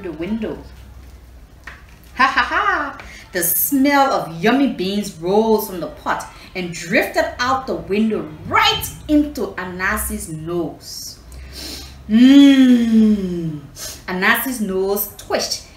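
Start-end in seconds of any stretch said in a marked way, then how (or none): 2.2-3.0 laughing. Real, not acted. Teller laughs at story. 12.1-13.6 mmm of delight. Acted. Smelling yummy beans.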